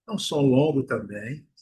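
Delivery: ordinary delivery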